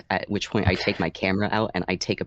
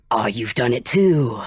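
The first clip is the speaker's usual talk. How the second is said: stagey, raised-voice praise, slowly drawn out; louder, stretched-out mock admiration; loud enough to overhear; 3 semitones higher, 6 dB louder than usual. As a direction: say it pointedly for others to hear. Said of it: "I say loudly enough for the other group to hear".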